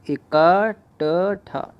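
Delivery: इकट्ठा drawn out very slowly, syllable by syllable; teacher stretching इकट्ठा slowly so students catch each sound; slow spelled-out word. Shown as a demonstration. Teacher to students, spelling out इकट्ठा very slowly. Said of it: "ये सही है, इकट्ठा".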